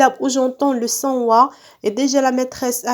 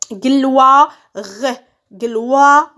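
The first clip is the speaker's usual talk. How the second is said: Gloire loud, stretched, final RE set apart; syllable by syllable; 4 dB louder than usual. Shown as a demonstration. Teacher cutting words into syllables, syllable by syllable. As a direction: sound out Gloire as GLOI, then RE. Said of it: "je découpe les mots en syllabes".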